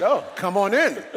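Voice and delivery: high, loud mock-eager welcome; suddenly welcoming, pitched well up. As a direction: imitate a gushing receptionist waving someone through. Retold receptionist welcoming him eagerly.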